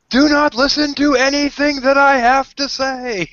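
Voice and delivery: very high, loud, slow proclamation; hammed-up corner preaching; mock street preacher. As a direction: holler it from a street corner, drawn out. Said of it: "standing on a street corner saying".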